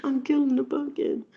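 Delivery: high, hammed-up fake wail; mock wail. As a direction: pretend to weep over the pumpkin.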